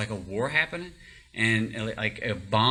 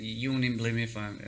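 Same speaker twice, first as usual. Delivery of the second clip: ordinary delivery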